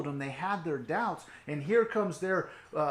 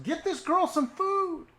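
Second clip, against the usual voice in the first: much higher, slow and bright command; cheerful command; 14 semitones higher, 6 dB louder than usual. Acted. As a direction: lift it up high and slow, delighted. Jesus after raising the girl.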